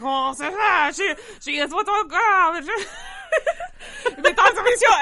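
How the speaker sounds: loud, laughing hammed-up French accent. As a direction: go big as a hammy French waiter. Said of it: "dumbass French accent".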